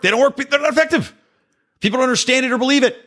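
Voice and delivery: very high-pitched, exasperated insistence. Real, not acted. Host on statistics failing to persuade, exasperated squeak. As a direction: pitch jumps way up in frustration.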